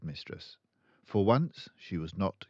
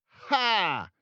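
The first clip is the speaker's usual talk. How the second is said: high, loud scornful Ha; scornful snort laugh; 9 dB louder than usual. A narrator's reading. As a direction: snort with contempt. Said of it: "snorted Miss Trunchbull".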